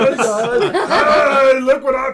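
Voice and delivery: loud, laughing "hey guys" parody; laughing mock shout. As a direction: yell it like an overexcited kid. Mocking the cheerful call.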